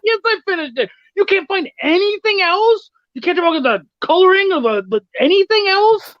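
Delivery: mock the useless praise, exasperated; indignant rant. Retold indignant reaction to feedback.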